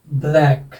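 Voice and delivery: "ब्लैक" with an open "ae" vowel; teacher modelling the short-a sound in "ब्लैक"; pronunciation demo. Shown as a demonstration. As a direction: say "ब्लैक" as one careful, isolated model word. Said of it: "इसका उच्चारण यहाँ पर तो क्या हो जाएगा".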